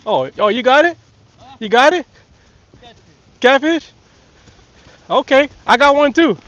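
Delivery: loud, high excited calls; shouting across. Real, not acted. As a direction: shout the news back across the water. Shouting to daddy across water.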